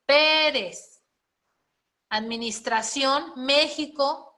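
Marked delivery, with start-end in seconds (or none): 0.1-1.0 "Pérez" loud and high on PÉ; stress on first syllable. Shown as a demonstration. Teacher giving an accent example, "Pérez" with a loud, high PÉ. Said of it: "qué otro acento va".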